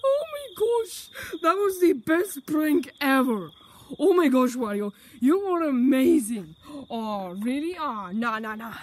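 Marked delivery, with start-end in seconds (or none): 0.0-6.6 excited. Acted. Celebrating prank success.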